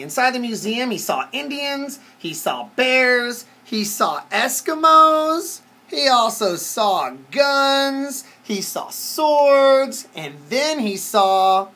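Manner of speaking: slow list with each item drawn out; reading to children. A narrator's reading. Reader lists museum sights for children, slow suspenseful storytelling. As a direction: build suspense, stretching the last word of each phrase.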